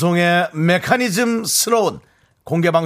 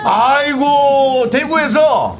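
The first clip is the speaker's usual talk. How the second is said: high, loud, stretched amazed exclamation; real surprise at visitors from Daegu; real delighted surprise; 8 semitones higher, 4 dB louder, much slower than usual. Real, not acted. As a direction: marvel that they came so far. Fans came from far Daegu.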